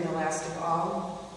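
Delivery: usual speaking voice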